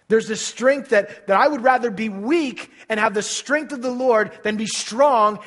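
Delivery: loud, high fervent preaching; preaching at full force. Acted. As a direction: build the sermon to a loud climax.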